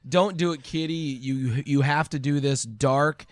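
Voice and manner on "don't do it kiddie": normal talking voice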